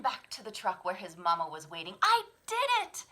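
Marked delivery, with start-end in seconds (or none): 2.0-3.0 triumphant cub. Acted. Proud cub returns.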